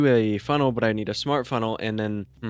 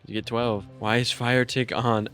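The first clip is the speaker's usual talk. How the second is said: normal talking voice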